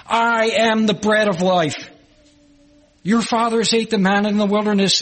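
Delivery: preaching at full force. Acted. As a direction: declare it boldly to the congregation. Preacher declaiming Jesus' words.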